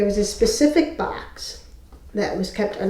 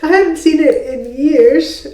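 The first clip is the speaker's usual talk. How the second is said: real laughing through tears, higher and louder; emotional laugh; 5 semitones higher, 8 dB louder than usual. Real, not acted. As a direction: laugh while welling up. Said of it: "I get very, uh, emotional".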